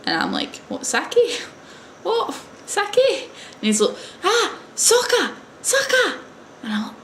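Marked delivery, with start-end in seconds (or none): 0.7-3.3 high-pitched puzzled questions; baffled. Retold or imitated. Visitor mishearing the teacher's word, acted bafflement. 4.2-6.2 very high, mock Japanese-accented insistence; imitated Japanese accent. Teacher repeating the misunderstood word, imitated Japanese accent. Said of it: "I can't say it right 'cause obviously I'm not Japanese".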